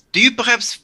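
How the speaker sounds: usual speaking voice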